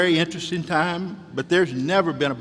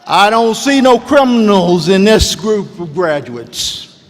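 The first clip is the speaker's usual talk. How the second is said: emphatic declaration; 4 semitones higher, 10 dB louder than usual. Real, not acted. Emphatic commencement speech line.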